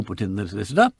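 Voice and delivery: usual speaking voice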